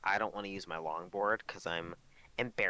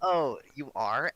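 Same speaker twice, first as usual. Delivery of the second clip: smug flirty; 4 semitones higher, 6 dB louder, slower than usual. Retold or imitated. Flirty streamer reply in retold TikTok.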